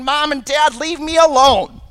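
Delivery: high, loud imitation of a storming-off child; mock angry child. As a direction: act out a child's outburst at the parents. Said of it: "storming off to their room and slamming".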